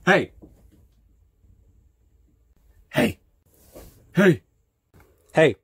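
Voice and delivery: short shouted hey; loud calls. Acted. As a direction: call out to the crowd. Calling out to viewers.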